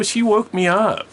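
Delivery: high, loud, drawn-out complaint; slow, loud childish accusation; indignant protest. Retold or imitated. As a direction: complain like an outraged little kid. Child protests to family, retold.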